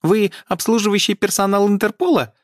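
high, squeaky officious elf. A narrator's reading. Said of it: "пискляво крикнул незнакомец".

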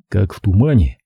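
usual speaking voice

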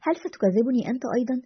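ordinary delivery

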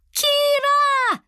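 very high, long-drawn scream. A narrator's reading. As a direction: scream the name for help. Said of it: "закричала Лиана". (пронзительный крик)

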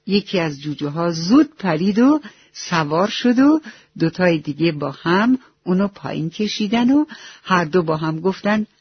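plain reading voice

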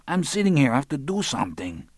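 high-pitched excuse; complaining phone character. A narrator's reading. Lionel on the phone, excusing himself.

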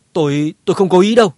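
high, loud, fast, stammering denial; frightened plea. Acted. Royal clan fighter pleads in terror with the association head. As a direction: blurt it out in panic. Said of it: "sợ hãi thanh minh".

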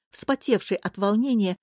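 usual speaking voice

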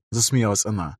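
plain reading voice

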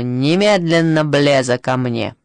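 slow, firm order; commanding summons. A narrator's reading. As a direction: summon a subordinate at once, slowly. Satan orders someone by intercom. (властно, медленно приказать)

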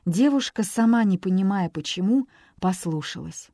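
ordinary delivery, nothing marked

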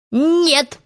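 high, scornful one-word denial; somewhat contemptuous. A narrator's reading. Peter answers Hook's guess, faint contempt. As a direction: dismiss it with a touch of scorn. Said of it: "с некоторым оттенком презрения".

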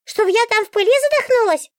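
very high, outraged book voice; the book bristles with outrage; indignant book. A narrator's reading. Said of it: "Книга просто негодовала". (возмущённо, очень высоким голосом книги)

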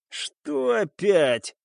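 irritated grimace. A narrator's reading. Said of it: "скривился пиратский главарь".

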